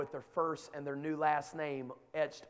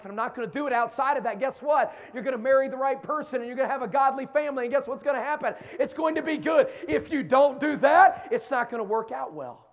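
higher, louder preaching build; rising preaching; 7 semitones higher, 8 dB louder than usual. Acted. Preacher telling youth about godly marriage, rising and loud. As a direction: push the point home to the youth.

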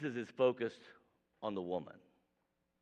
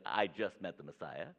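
lower, a touch softer, mock unimpressed; jaded old believer; 7 semitones lower, 3 dB quieter than usual. Longtime believer mocking his own faded zeal, flat. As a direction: toss it off like old news. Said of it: "those of us who are old in our faith".

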